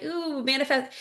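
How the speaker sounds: a hint of gushing coach; mock manifestation coach. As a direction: lightly imitate a hyped manifestation coach. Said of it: "manifestation coaches and stuff all seem to be talking about".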